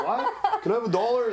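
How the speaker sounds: laughing. Retold or imitated. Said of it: "I'm like".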